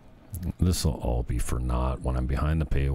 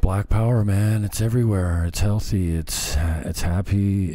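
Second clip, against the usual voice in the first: sarcastic cheer; 4 semitones higher, 5 dB louder than usual. Pretend. Sarcastic mock cheer of quote.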